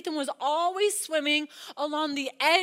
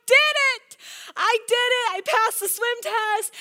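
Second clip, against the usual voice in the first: put-on higher and louder, a child's joyful cry; tearful triumph; 7 semitones higher, 6 dB louder than usual. Son to mama, higher, loud tearful joy. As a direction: shout it out through happy tears. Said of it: "tears are streaming down his face".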